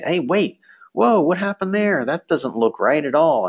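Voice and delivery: sudden alarmed high voice; hey wait, what happened; panicked surprise. Pretend. Student panicking over grades.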